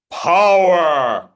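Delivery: power hit loud and strong; loud power demo. Shown as a demonstration. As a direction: punch power out with force. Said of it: "talking about power".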